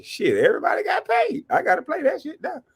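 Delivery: high, hyped-up call; excited yell. Real, not acted. Streamer hyped over a donation, high excited call. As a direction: burst out with real excitement.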